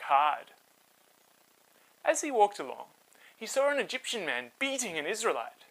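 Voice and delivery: put-on lively read-aloud voice for kids; storybook narration. A narrator's reading. Host telling children a Bible story, animated narration.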